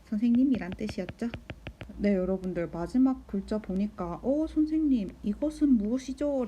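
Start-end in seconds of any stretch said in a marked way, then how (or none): 4.2-6.4 higher-pitched student asking teacher; questioning student. Acted. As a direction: put on a puzzled pupil's question. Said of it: "라는 질문을 당연히 할 수 있어요".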